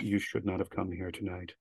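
low, soft, foreboding; lightly acted spooky warning, kept low and hushed; ominous warning. Druids greeting visitors at the elevator, low ominous warning.

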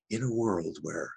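slow trailer-style opener; lightly put-on movie-trailer voice; Don LaFontaine. Shown as a demonstration. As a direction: ease slowly into trailer-narrator mode. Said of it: "I begin my little audition like that".

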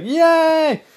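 very high, loud squeal of joy; excited yay. Retold or imitated. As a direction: squeal like a delighted child. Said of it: "And I was like".

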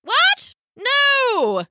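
high, loud shocked cry; shocked outcry; cry of protest. Acted. Elder female penguin crying out against feeding the thief to seals. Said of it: "cried Pippo".

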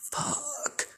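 high, soft, drawn-out squeak of dread; dread-filled whisper. Retold or imitated. Girls summoned by the angry dad, acted whispered dread. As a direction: breathe it out in mock horror.

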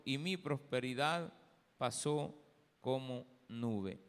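solemn, projected Bible reading with measured phrases; declaimed scripture, firm and deliberate. Real, not acted. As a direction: read the passage out to a congregation, each phrase set apart. Reads scripture aloud to congregation.

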